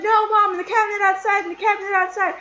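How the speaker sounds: high, loud insistent shout; acted frantic yelling. Kid corrects Mom about where cats are, re-enacted yelling. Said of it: "I was yelling so loud".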